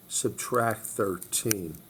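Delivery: very slowly, each word drawn out; walk students through it slowly; slow, deliberate repeat. Shown as a demonstration.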